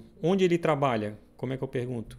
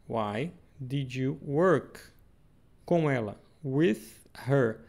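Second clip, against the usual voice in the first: slow dictation; much slower than usual. Shown as a demonstration.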